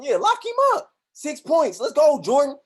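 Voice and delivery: high, loud mocking hype; Garnett taunting. Garnett taunting Jordan from the bench, high and loud. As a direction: egg him on teasingly. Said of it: "Kevin Garnett was talking".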